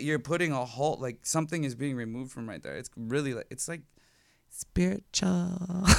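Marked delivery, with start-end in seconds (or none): 4.5-5.8 very slow, soft, higher single word; drawn-out reverence. Real, not acted. Describing what piercing feels like, drawn-out reverence. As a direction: stretch it out softly, savoring it.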